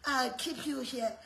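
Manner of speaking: low, hushed, coaxing devil; devil's voice. Acted. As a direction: play the devil softly luring someone in. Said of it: "He's saying".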